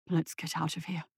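a spooked man asking to leave; shaken murmur. Acted. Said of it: "sucked in a ragged breath".

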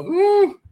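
high, loud "Woo!"; sudden high-pitched whoop; excited woo. Real, not acted. Excited about women he likes.

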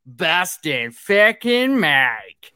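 put-on drawn-out Boston accent; slow, stretched announcer shout-out in a Boston accent. Host introducing his co-host, Boston accent. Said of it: "That was my Boston accent".

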